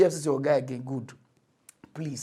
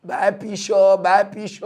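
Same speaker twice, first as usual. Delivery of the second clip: mock hawker; 3 semitones higher, 8 dB louder, slower than usual.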